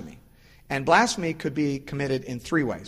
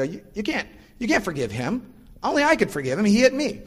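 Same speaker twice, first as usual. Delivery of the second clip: put-on higher, rising protest of a wronged little boy; indignant hit kid; 7 semitones higher than usual. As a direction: squeak out the complaint like the kid who got hit. Hit boy protesting unfair forgiveness.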